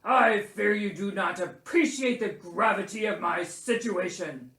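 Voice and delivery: loud, pompous bear voice; growling, indignant Bear. A narrator's reading. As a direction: grumble self-importantly as a sick bear. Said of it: "growled Bear".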